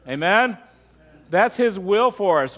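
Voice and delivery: ordinary delivery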